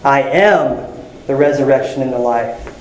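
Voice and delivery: "am" stressed with a pause after it. Shown as a demonstration. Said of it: "you just emphasize a different word".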